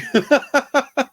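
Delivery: high, loud burst of laughter; real giggling fit at their own tease; amused laugh. Real, not acted. Laughs at his own teasing.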